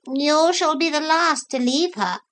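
high, gentle consoling voice; consoling parrot. A narrator's reading. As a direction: comfort him as the kindly parrot. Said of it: "said the parrot consolingly".

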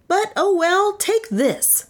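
high, put-upon concession; complaining. A narrator's reading. Inventor hands baton to the sceptic, complaining. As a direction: give in grudgingly, voice pitched high. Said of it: "he complained".

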